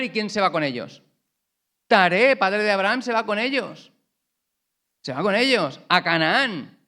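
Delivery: fervent preacher building the story; preaching at full force. Acted. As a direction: preach it out with fire. Passionate retelling of Terah.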